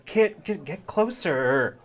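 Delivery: high, slow calling out; calling mom. Acted. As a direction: call the kids in closer. Mom calls kids into photo.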